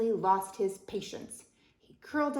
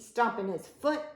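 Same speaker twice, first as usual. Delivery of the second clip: usual speaking voice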